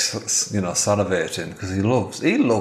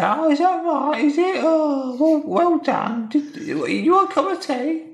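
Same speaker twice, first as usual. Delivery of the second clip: much higher, sweet and prim wife voice. Acted. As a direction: play the chirpy wife humouring him. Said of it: "And she said".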